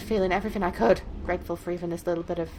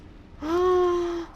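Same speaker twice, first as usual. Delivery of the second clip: high, drawn-out gasp; sharp alarm on a long breath in; 9 semitones higher, 5 dB louder than usual. Acted. Reader as captive hearing someone coming, alarmed gasp. Acted gasp at approaching noise.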